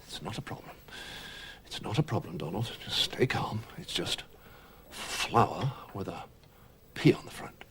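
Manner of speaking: unvoiced self-soothing whisper; whispering to himself. Real, not acted. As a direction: talk yourself down under your breath. Calming himself under his breath.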